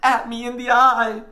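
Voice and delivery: put-on high, loud boyish panic; shocked sputtering. A narrator's reading. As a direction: insist on it, horrified. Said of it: "sputtered the boy".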